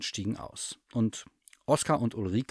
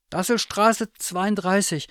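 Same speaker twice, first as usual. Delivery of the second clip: high, loud, slow bear voice; stuffed bear character; 11 semitones higher, 6 dB louder than usual. A narrator's reading. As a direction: announce it high and deliberately. Bear announces the address.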